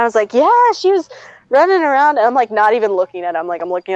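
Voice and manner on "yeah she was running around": high-pitched, too-keen cover story; overeager fibbing. Retold or imitated. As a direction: pitch it up like a bad liar. Lying to parents about sick puppy.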